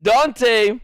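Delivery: usual speaking voice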